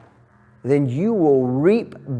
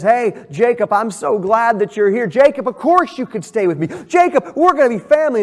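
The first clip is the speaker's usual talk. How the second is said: higher, faster, over-friendly uncle; eager uncle; 6 semitones higher, 3 dB louder, faster than usual. Acted. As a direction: gush with a host's welcome, building faster. Uncle Laban welcoming Jacob.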